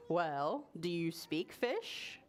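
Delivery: low, slow, measured question; calm low voice for a wise tree; tree character. Acted. Tree questioning a boy about fish, low and measured. Tree's voice against boy's.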